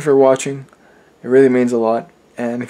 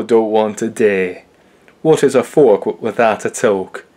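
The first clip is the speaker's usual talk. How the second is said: put-on Sheffield accent; slower than usual. Reciting his dialect poem in a Sheffield accent. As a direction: declaim in old Sheffield accent. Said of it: "I'll try to do the best, uh, Sheffield accent I can do".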